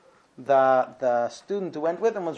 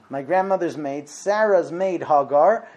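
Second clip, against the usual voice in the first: ordinary talk, nothing marked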